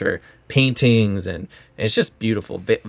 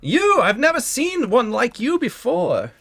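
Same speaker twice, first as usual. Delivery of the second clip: very high, wonderstruck greeting; effusive mayor; 13 semitones higher, slower than usual. Acted. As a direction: gush over an extraordinary guest. Mayor marvels at a guest.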